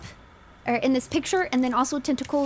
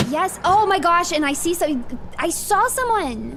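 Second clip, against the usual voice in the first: higher and louder burst of alarm; excited alarm; 6 semitones higher, 4 dB louder than usual. Real, not acted. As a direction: blurt it out, startled. Startled by a figure.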